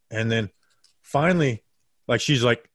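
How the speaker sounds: ordinary delivery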